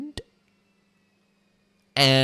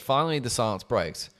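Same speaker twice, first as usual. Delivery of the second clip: ordinary delivery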